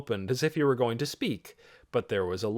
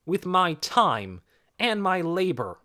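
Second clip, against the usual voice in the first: higher, slow, measured insistence; firm protest; 7 semitones higher, 3 dB louder than usual. A narrator's reading. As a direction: spell out what you gave. Boy answers the accuser.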